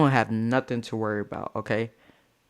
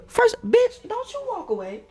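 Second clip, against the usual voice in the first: high furious shout after her; angry call-out. Retold or imitated. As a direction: yell it at her back. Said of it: "I said".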